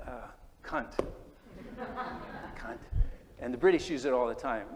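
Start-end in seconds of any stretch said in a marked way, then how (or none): 1.5-2.5 short airy laugh up high; a quick high giggle; high breathy giggle. Real, not acted. Laughs at the swear word.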